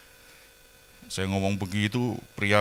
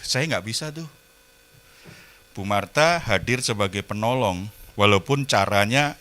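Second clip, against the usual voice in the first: ordinary talk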